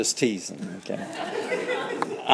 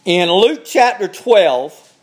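ordinary talk

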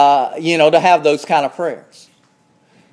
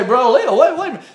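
higher-pitched interrupting listener; mock objection; 6 semitones higher, much faster than usual. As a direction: cut in as a protesting churchgoer.

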